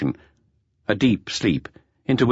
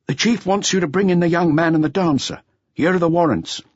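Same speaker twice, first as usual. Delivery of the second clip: a touch higher, matter-of-fact colleague; a lightly voiced colleague handing over warrants; lightly voiced policeman; 11 semitones higher than usual. A narrator's reading. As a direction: report the orders briskly, lightly voiced.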